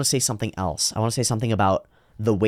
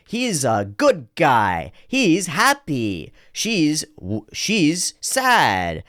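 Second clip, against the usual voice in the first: put-on high, slow, sing-song kid voice; imitated child; 6 semitones higher, much slower than usual. Child naming cartoon characters' feelings, imitated kid voice. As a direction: swoop through each word like a small child. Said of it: "the child does this exact same thing".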